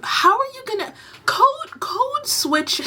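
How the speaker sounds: high-pitched, baffled disbelief; incredulous. Real, not acted. Vlogger questioning the code-switch claim, incredulous.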